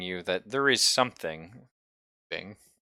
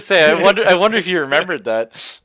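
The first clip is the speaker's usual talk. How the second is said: loud, high, fast burst of glee; excited outburst; 11 semitones higher, 15 dB louder, faster than usual. Real, not acted. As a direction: shout it out, delighted at the player's trick. GM reacts to clever player.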